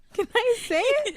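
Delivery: giggling through the words; laughing. Real, not acted. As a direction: ask it while laughing. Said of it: "I'm wheezing".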